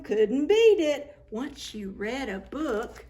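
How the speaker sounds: lively rhyming storybook read; reading to children. Acted. Reader tells children a rhyming story, lively storybook reading. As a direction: bounce the rhymes for young listeners. Reads rhyming picture book aloud.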